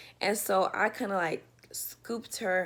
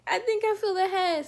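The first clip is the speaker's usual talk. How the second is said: much higher, louder retold excitement; excited; 12 semitones higher, 4 dB louder than usual. Telling the dispatcher she feels the head, excited. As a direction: pitch up with the thrill of it. Retelling feeling baby's head.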